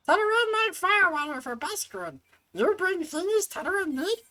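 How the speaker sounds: high squeaky goblin merchant; Tetaroon voice. Acted. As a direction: chirp it like a tiny trader.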